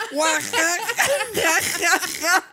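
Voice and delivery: high, slow, exploding mock guffaw; uncontrollable funeral laughter. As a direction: burst out in helpless acted laughter. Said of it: "te cagáis de la risa".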